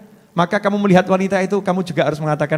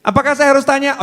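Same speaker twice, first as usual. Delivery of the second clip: high, louder questioning woman; mock wife asking; 8 semitones higher, 3 dB louder, faster than usual. Preacher voicing the wife asking if she should check, high. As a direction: ask it in a raised woman's voice.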